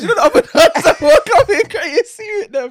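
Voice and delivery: cracking up mid-sentence; laughing. Real, not acted. Laughing at his own image.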